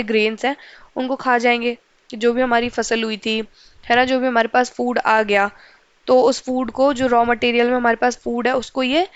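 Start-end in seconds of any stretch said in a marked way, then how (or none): none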